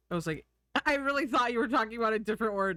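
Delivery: normal talking voice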